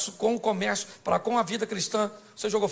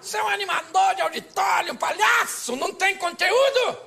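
high, hammed-up scolding shout; exaggerated high-voiced jeering; mocking critics; 11 semitones higher, 5 dB louder than usual. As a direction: imitate outraged critics, pitched way up. Mimics critics insulting him.

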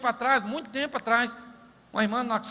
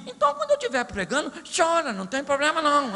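quick mock aside; 4 semitones higher, 3 dB louder, faster than usual.

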